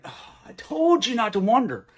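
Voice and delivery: mock annoyed. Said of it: "He's not muttering".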